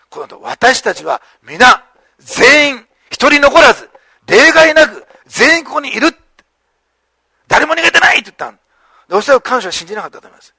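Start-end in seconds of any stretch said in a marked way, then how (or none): 0.4-8.2 building from slow and measured to a high, loud cry; loud call. Retold or imitated. Paul shouting from inside the cell to stop the jailer, building. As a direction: call out to stop him, stressing every word. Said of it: "パウロ大声で言います".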